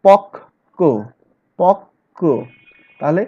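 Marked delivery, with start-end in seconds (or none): 0.0-2.2 পক্ক said as pok-ko with a held double k; slow demo of পক্ক with the k held long; pronouncing a doubled k. Shown as a demonstration. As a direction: stress the doubled consonant in পক্ক. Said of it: "আমরা কি বলি?".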